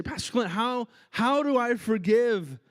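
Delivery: pleading congregant. Retold or imitated. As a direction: voice a hurting person begging for an answer. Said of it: "You say".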